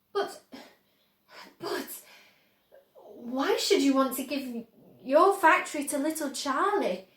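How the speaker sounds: lightly voiced Grandpa Joe, stumbling; bewildered old man. A narrator's reading. Grandpa Joe questioning Wonka, flustered. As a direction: splutter in confusion.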